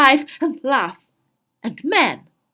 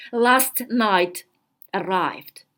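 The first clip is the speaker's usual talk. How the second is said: ordinary delivery, nothing marked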